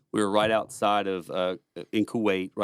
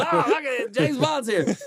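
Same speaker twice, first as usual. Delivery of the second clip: much higher, laughing, hammed-up alarm; mock panicked Taliban; 14 semitones higher than usual. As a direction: play the spooked enemy for laughs. Taliban imagined hearing them.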